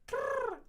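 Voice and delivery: high rolled krrr; repeated krrr call. Shown as a demonstration. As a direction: roll it sharply. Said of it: "repeating the sound".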